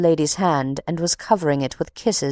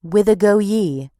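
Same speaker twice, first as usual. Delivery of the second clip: Eleazer's line; 4 semitones higher than usual. Retold or imitated. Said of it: "Eleazer stops them and says".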